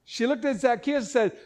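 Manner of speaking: usual speaking voice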